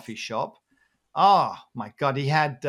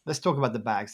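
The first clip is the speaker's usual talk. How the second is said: usual speaking voice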